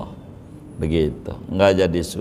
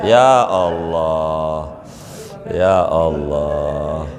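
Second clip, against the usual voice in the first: slow, stretched mock lament; hammed-up slow wail to God; drawn-out sigh; 3 semitones lower, much slower than usual. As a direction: let each Ya Allah drag out in pity.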